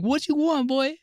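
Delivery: drive-thru order voice. Retold or imitated. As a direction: squeak it out like a different man at the speaker box. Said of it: "I'll be talking like this".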